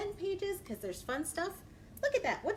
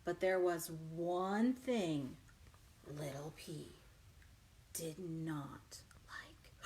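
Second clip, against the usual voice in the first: low, slow, soft whisper; suspenseful hush; 8 semitones lower, 5 dB quieter, slower than usual. A narrator's reading. Storyteller building suspense before the twist, whispering.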